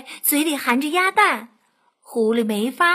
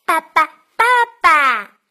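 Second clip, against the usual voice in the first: loud, insistent little duckling; duckling calling out; 2 semitones higher, 7 dB louder than usual. A narrator's reading. Duckling on the fox's head, calling loudly. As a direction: call loudly as a pestering baby duckling.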